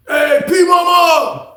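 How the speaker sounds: high, drawn-out holler; calling out. Acted. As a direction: holler slowly across a prison yard. Calling to someone across the yard.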